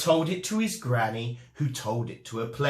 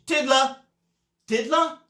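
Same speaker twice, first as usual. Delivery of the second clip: calling out; 10 semitones higher, 4 dB louder than usual. Acted. Teacher calling absent pupil.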